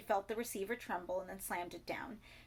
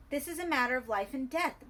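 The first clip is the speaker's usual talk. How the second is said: loud, urgent insistence; insisting; 5 semitones higher, 8 dB louder than usual. A narrator's reading. Bertie insisting to the caller, loud and urgent. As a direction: press the point hard and loud. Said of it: "Bertie insisted".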